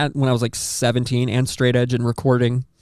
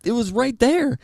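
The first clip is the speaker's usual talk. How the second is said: scared refusal; 10 semitones higher than usual. Retold or imitated. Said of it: "And I was like".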